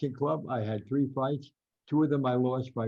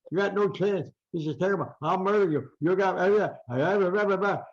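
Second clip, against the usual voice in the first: high-pitched mock boxer's tirade; crazy ranting; 7 semitones higher, 3 dB louder than usual. Imitating the boxer threatening his rival at weigh-in, crazy ranting. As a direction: rattle off threats up high, dissolving into babble. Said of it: "he's crazy".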